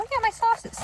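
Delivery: usual speaking voice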